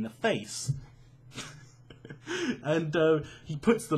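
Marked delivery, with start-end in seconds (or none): 1.9-2.6 a short high burst of laughter; quick giggle at his own story. Real, not acted. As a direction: laugh at the memory.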